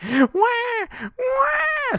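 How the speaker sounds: very high, loud wailing cry; baby screams after its mother falls. Acted. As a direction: wail like a newborn.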